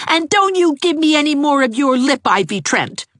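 put-on high angry boy's yell; furious shout. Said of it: "shouted Gerald furiously".

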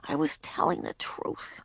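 whispered, soft repeat. Acted. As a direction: say it to herself in a whisper. Repeated inner thought.

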